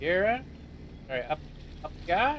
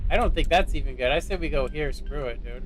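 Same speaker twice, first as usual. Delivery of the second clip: ordinary talk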